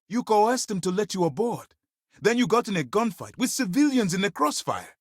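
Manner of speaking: high-pitched indignant accusation; appalled, scolding officer; angry reprimand. Acted. Officer reprimands agent over gunfight.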